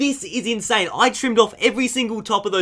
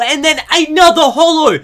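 high, loud, building reveal; excited shout; 10 semitones higher, 10 dB louder than usual. Real, not acted. Reveals another holo card.

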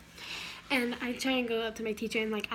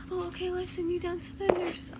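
higher-pitched teacher imitation; retold teacherly concern; helpful teacher; 6 semitones higher than usual. Teacher voiced sending pupil to nurse.

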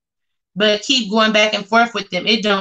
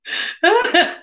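high laugh; 11 semitones higher than usual. Real, not acted. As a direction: crack up at her own joke. Laughs at her own impression.

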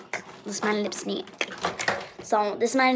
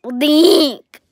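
exasperated; 4 semitones higher, 7 dB louder, slower than usual. Retold or imitated. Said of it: "And I'm like".

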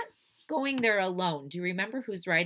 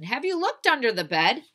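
loud, raised, urgent question; 7 semitones higher, 8 dB louder than usual. Acted. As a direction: press a worried wife for an answer. Husband questioning alarmed wife.

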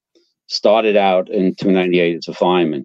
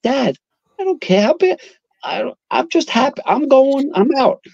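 eager son. Retold or imitated. Son answering screaming father.

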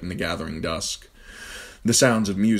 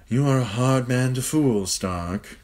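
sly, smirking Littlefinger; 7 semitones higher, slower than usual. Acted. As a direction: tease him with a smirk. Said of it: "Littlefinger said with a smirk".

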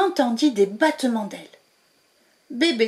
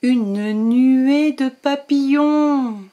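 slow, louder wonder-filled reveal; wondrous reveal; 3 semitones higher, 5 dB louder, much slower than usual. A narrator's reading. Narrator revealing a cloud of butterflies, slow and marvelling. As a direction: stretch it out with delight.

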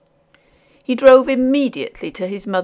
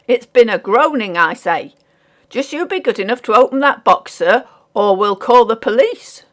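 loud, higher, demanding working man; insistent porter; 4 semitones higher, 8 dB louder than usual. A narrator's reading. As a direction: lay down the demand firmly.

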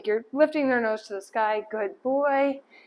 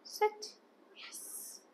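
whispering; 12 semitones higher, 14 dB quieter than usual. Real, not acted. Whispered command and marker to dog.

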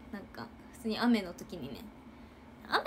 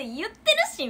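higher, louder snapping back; indignant retort; 9 semitones higher, 7 dB louder than usual. Retold or imitated. Captain snapping back at the teacher, higher and louder. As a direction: protest that you already did. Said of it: "みたいな言われて".

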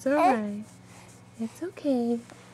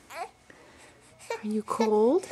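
put-on tender baby talk; 3 semitones lower, much slower than usual. Asking baby, slow soft.

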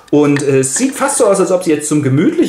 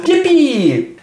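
much higher joyful whoop; cheer; 12 semitones higher than usual. Acted. Young man thrilled about the party, high whoop. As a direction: squeal it out with glee.

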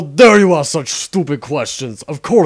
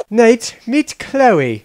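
drawn-out, slow, higher intro; slow introduction; 6 semitones higher, 4 dB louder, much slower than usual. Acted. Narrator slowly introduces Chloe in a flashback. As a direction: stretch it out like a flashback.

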